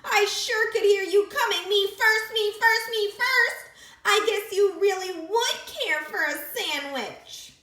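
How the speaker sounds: high, loud, teasing voice; mock his cries; high creature voice. A narrator's reading. Creature teasing the piglet about his shouting.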